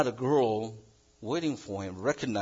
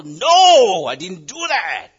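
shouted denial, high and loud; raised voice; 6 semitones higher, 11 dB louder, slower than usual. Retold or imitated. Someone wrongly accused, a loud shouted denial. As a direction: raise your voice to defend yourself. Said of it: "raise our voices".

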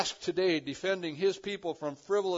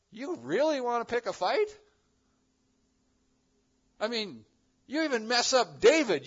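challenging; 6 semitones higher, 5 dB louder than usual. Retold or imitated. Jesus challenging the Pharisees.

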